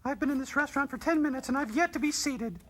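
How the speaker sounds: very high put-on voice; complaining diner. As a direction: whine to the staff in falsetto.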